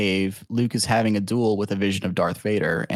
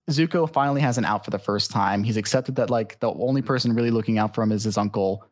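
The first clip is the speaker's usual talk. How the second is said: usual speaking voice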